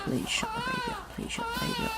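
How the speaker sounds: high, whiny pleading; desperate plea. Acted. Passenger begging the parents to silence the baby. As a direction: beg like you're at your wit's end.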